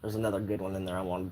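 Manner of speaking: voiceless murmur under a sigh; half-whispered, searching his notes; muttering to self. Real, not acted. Vlogger murmuring to himself while searching for the next story, barely voiced.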